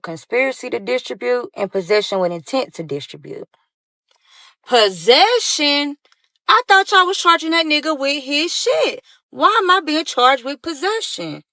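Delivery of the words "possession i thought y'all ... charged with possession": high, loud, incredulous protest; indignant disbelief. Retold or imitated. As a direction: protest the charge in outraged, high-pitched disbelief. Acted indignant reaction to the charges.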